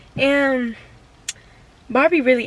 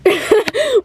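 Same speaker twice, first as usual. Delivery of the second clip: higher, louder burst of laughter; 8 semitones higher, 4 dB louder than usual. Real, not acted. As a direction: laugh out loud at the failed zoom. Laughing at the camera.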